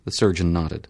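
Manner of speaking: ordinary delivery, nothing marked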